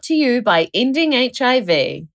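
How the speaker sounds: bright sponsor read. Acted. Podcast host reading sponsor message, upbeat ad read.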